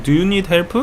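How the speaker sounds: put-on halting Korean-accented directions to a lost tourist; helpful Korean local's accent. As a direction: play a friendly Seoul local with a Korean accent. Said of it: "people will come up to you and say".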